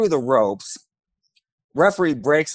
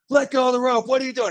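higher, angry mock yell of a referee; retold yelling referee; 10 semitones higher, faster than usual. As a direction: bark it at him like a furious ref. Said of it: "The referee starts yelling at me".

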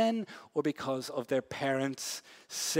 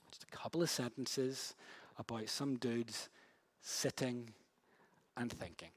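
slowed, hushed narration; 3 semitones lower, 10 dB quieter than usual. Shown as a demonstration. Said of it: "you can feel the whole narrative slow down".

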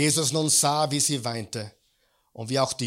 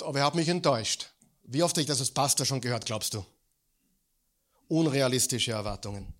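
usual speaking voice

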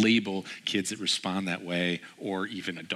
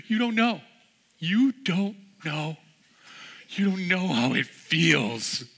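put-on drawn-out, louder child's lament; mock heartbroken boy, high and slow; high, slow wailing kid; 9 semitones higher, 4 dB louder, slower than usual. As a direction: stretch it out like a kid who can't be consoled. Boy protests to his father.

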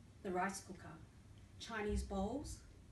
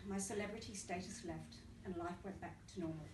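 ordinary delivery

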